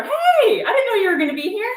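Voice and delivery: excited. Real, not acted. Sister spots her sister joining the live chat, excited and fast. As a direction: gush with surprised delight, fast and high.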